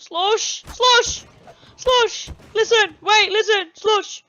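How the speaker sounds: high, insistent calls; urgent calling. Pretend. Calling possessed Slush to listen, urgent calling. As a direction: call out sharply to get through.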